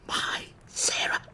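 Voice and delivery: a grieving, breathless whisper of her name. Acted. Grieving lover recalls dead woman.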